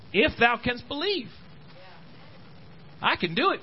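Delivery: high, ringing challenge as Jesus; Jesus challenging. Retold or imitated. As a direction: throw the question back with lifted pitch. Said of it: "He says to him".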